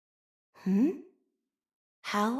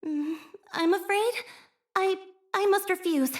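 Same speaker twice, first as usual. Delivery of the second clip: higher, stammering decline; flustered refusal; 9 semitones higher than usual. Acted. Shy operator turning down the sports festival, flustered. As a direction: back out nervously.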